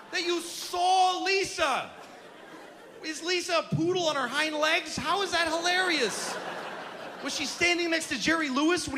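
high, exasperated rant; mock outraged rant. Comic ranting at the guy who said hilarious, high and outraged. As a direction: shout your disbelief at the dude.

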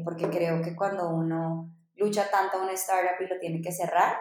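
ordinary talk

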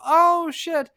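hammed-up surprised joy; retold excitement. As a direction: burst out thrilled at the package. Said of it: "And I go".

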